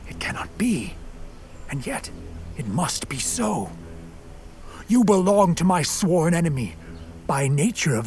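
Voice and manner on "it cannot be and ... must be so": hushed, low, near whisper; stunned realisation. Acted. Monster learning the child's name, stunned hushed realisation. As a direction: murmur it to yourself in disbelief.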